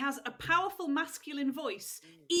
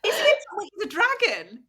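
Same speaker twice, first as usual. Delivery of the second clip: surprised; 7 semitones higher, 9 dB louder than usual. Real, not acted. Surprise at dragon reveal.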